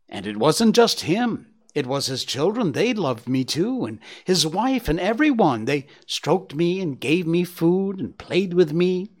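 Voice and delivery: lightly voiced boastful young deer; proud youngster showing off his tale; Gobo the young deer. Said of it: "Gobo said".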